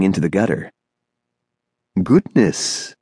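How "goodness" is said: a lightly sung, lilting tall stranger; musical voice. A narrator's reading. Tall thin man looking down on Horace, lightly musical. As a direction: let the word float down musically. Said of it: "said a musical voice from high above".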